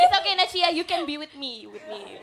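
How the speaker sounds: a touch of Australian English, fading at the end; Australian accent. Pretend. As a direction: speak in a hint of an Aussie accent, trailing off. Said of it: "Oh, Australia".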